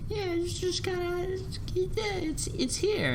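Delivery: very high squeaky mock voice; high squeaky imitation; mock casual. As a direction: squeak it out playfully.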